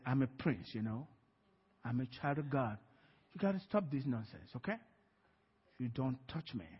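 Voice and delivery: low, soft retold warning; quiet authority. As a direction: tell the demon off under control, without raising your voice. Calmly telling off the demon, retold.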